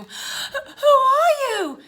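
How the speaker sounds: high, loud, stammered question; drawn-out, alarmed girl's demand; frightened demand. Acted. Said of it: "demanded Stella".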